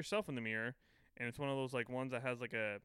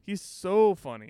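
higher, louder, slow exaggerated praise; mock gushing; 6 semitones higher, 10 dB louder, slower than usual. Friend gushes over a comic, loud and slow. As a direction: overdo the praise.

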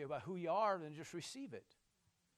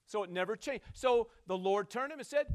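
ordinary talk, nothing marked

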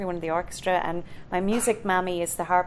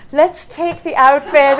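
higher, louder and slow, hammed-up grandeur; theatrical steward; 7 semitones higher, 12 dB louder, slower than usual. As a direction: play it like a showman. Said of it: "he was being so theatrical".

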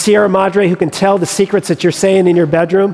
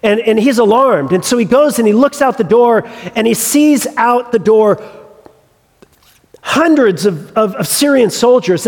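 ordinary talk, nothing marked

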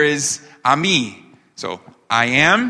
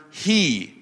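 "he" said alone and drawn out; stress on he; 3 dB quieter than usual. Shown as a demonstration. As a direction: isolate "he" as the key word. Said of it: "circle that word".

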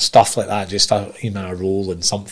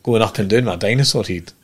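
ordinary talk

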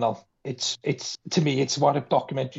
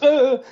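mock spit-out grunt; 12 semitones higher, 9 dB louder than usual. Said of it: "and he spits it out".

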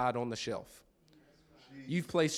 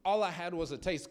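ordinary talk, nothing marked

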